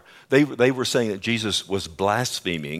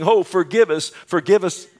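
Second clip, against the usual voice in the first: high, loud pleading; mock pleading cry; 8 semitones higher, 6 dB louder than usual. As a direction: cry out begging for mercy, hammed up. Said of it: "they cried out".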